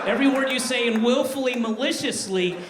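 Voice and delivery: a parent's growing fury at the fans; rising outrage. Acted. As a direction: let the indignation swell toward the last words.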